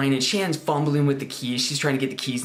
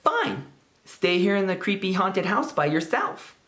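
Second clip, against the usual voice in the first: mean taunting sister; 6 semitones higher than usual. Retold or imitated. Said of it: "the meanest thing my sister's ever done".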